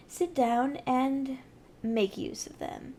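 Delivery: ordinary delivery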